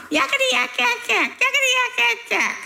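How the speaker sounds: high, piercing yakety chatter; demon's crying racket. Acted. Little demon drowning out Jesus's teaching, shrill crying racket. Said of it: "this little demon began jumping up and down, crying".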